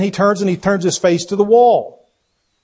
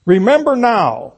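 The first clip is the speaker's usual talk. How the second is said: slow, higher-pitched demand; 4 semitones higher, 4 dB louder than usual. Acted. Teacher voices a king's prayer to God, demanding. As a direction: press it as an insistent demand. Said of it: "sort of a demand that comes with that".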